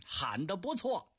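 ordinary talk, nothing marked

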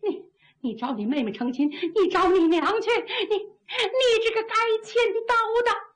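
stammering woman's outburst, rising high; frantic indignation. A narrator's reading. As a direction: hurl curses, pitch climbing as you go. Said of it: "这女的急了". (姑娘又急又气地骂)

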